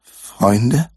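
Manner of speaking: shaky, wavering voice; wobbly quivering jelly voice. Acted. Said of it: "mit wackeliger Stimme".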